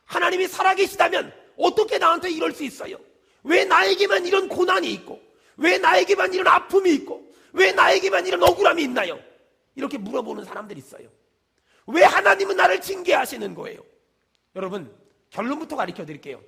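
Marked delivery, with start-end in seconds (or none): 0.1-9.2 loud, higher protesting wail; indignant outcry. Retold or imitated. Sufferer protesting to God, loud and higher. Quoted complaint to God, rising. 11.9-13.8 protesting. Retold or imitated. Quoted complaint.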